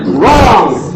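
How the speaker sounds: loud game-show buzzer no; shouted. Real, not acted. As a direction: shout it, playful but firm. Third rejection, shouted.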